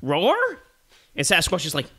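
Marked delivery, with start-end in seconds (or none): 0.0-0.4 short roar rising like a question. Acted. Bear looking around, short questioning roar. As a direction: roar it as a puzzled query.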